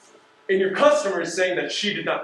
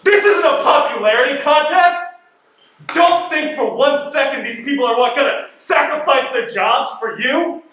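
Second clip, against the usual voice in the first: shouting furiously at him; loud exasperated yelling; angry outburst; 8 semitones higher, 7 dB louder than usual. Acted. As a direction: blast him with rage. Investigator blows up at supervisor.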